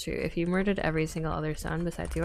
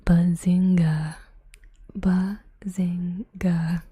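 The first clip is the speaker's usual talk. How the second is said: drawn-out whisper; 7 dB louder, much slower than usual. Pretend. Exaggerated slow whispered trigger.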